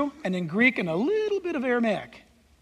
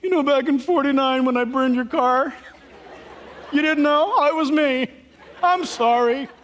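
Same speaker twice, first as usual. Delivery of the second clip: hammed-up tearful drunk confession, raised voice; emotional drunk; 2 semitones higher, 4 dB louder than usual. Drunk confessing to his friend, overly emotional. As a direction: confess loudly with boozy mock remorse. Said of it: "they get real emotional".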